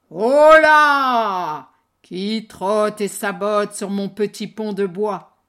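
loud roaring troll voice. Acted. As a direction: roar from under the bridge. Troll roars at second goat.

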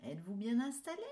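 bright storyteller welcome; reading to children. A narrator's reading. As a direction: greet the little ones warmly.

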